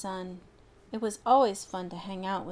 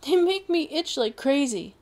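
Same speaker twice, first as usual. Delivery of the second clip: high, loud, itchy distress; distressed complaint; 6 semitones higher, 7 dB louder than usual. Acted. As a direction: whine about the itching. Dog attacked by bees.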